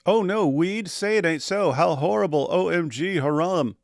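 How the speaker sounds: put-on pearl-clutching outrage; mock horror. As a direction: feign scandalised dismay. Mocks horror over weed smoking.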